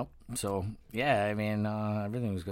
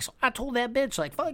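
put-on much higher girl voice, gossiping; sassy phone gossip; 13 semitones higher than usual.

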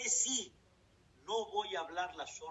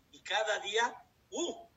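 ordinary delivery

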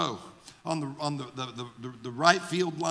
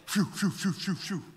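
quick airy whooshes, trailing softer; imitated whizzing rock; 3 dB quieter than usual. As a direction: puff a string of short whizzing noises. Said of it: "when that rock whizzed by his head".

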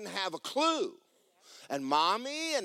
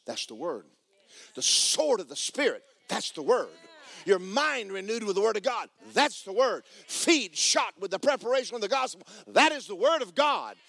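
building preaching, louder and higher to the end; preaching refrain; 6 semitones higher, 3 dB louder than usual. Acted. Pastor to the congregation, building preaching. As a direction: drive the refrain home harder each time.

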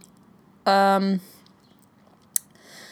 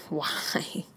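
soft unvoiced whisper; 3 semitones lower, 9 dB quieter than usual. Real, not acted. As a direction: whisper it to yourself. Whispering reaction.

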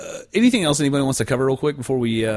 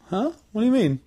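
mock confused kid; 11 semitones higher than usual. Voicing himself to mom.

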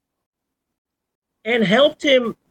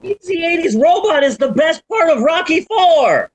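indignant shout; 9 semitones higher than usual. Acted. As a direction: yell it like a manic rant. Passionate sketch-style rant.

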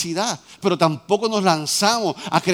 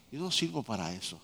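mock defeated mumble; 7 semitones lower, 11 dB quieter than usual. Diálogo actuado, persona desanimada.

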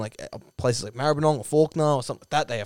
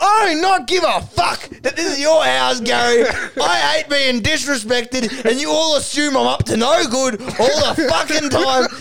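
high-pitched, hammed-up tirade; angry rant; 12 semitones higher, 3 dB louder than usual. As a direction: rage through it like an offended hothead. Duck's acted angry rant.

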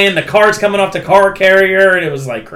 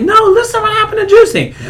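much higher, fast, laughing put-on voice; hammed-up comedian voice; 4 dB louder, faster than usual. Friend retelling a comedy bit pitches way up to mimic the comedian, laughing. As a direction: push the story eagerly with a squeaky laugh in it. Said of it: "He was like".

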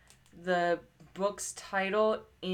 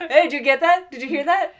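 excited call-out; 7 semitones higher, 11 dB louder, faster than usual. Retold or imitated. Retells pointing out movie title.

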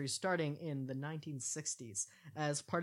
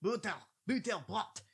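slow, higher, mock German on "butterbrot"; bad German accent; 6 semitones higher, 4 dB louder than usual. Host apologizing to Germans while faking a German accent. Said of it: "sorry to any German listeners".

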